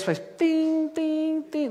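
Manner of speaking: tim tim bem agudo, como campainha; sinal agudo imitado. Retold or imitated. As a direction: imita o bipe do avião em tom alto. (imitação aguda de campainha)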